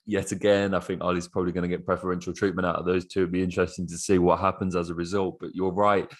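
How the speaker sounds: ordinary delivery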